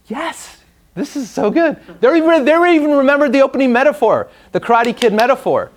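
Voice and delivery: thrilled the class remembered; delighted. Real, not acted.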